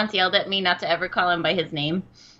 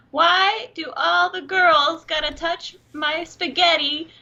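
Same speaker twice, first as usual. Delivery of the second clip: put-on higher, slow kid voice with a New York whine; quoting a viral kid about his spaghetti; little New Yorker kid; 8 semitones higher, 4 dB louder, slower than usual. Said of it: "this little New Yorker kid".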